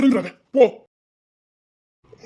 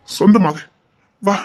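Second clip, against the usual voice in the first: ordinary talk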